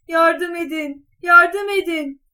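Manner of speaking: loud, high, drawn-out cry for help; shouting for help. A narrator's reading. Cat alerting the king's carriage, shouting for help. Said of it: "diye bağırmış".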